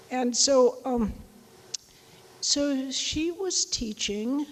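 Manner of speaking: ordinary delivery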